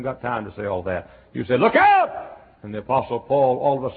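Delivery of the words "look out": loud, high yell of alarm; warning yell. Retold or imitated. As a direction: shout it to stop a child. Warning a child about car.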